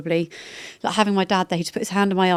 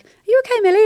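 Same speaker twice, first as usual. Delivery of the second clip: high-pitched, louder worried question; imitated concerned colleague; 7 dB louder, much faster than usual. Voices colleague answering her sick call.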